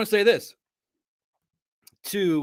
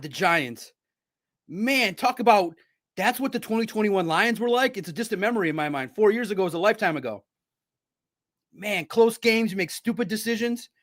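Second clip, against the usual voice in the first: normal talking voice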